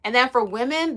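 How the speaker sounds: usual speaking voice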